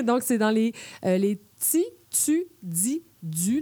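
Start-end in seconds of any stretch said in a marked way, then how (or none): 1.2-3.6 "ti, tu, di, du" with an added z, as "tsi, tsu, dzi, dzu"; Québec affrication. Shown as a demonstration. Showing listeners the Québécois affricated t and d. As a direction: hiss a little z after each t and d. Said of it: "on ajoute un Z".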